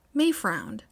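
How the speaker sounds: usual speaking voice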